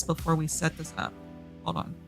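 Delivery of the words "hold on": ordinary delivery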